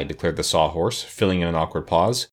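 plain reading voice

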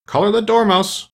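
high, loud shriek of command; queen's shrill, forceful order. A narrator's reading. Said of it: "the queen shrieked out".

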